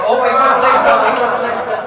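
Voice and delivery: mock exasperated. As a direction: throw up your hands over him. Mock exasperation over his friend.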